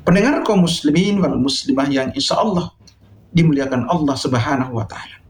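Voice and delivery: usual speaking voice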